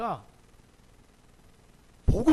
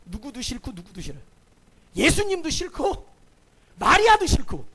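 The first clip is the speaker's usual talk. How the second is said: resentful; 3 semitones higher, 6 dB louder than usual. Retold or imitated. Voicing Martha's resentful heart.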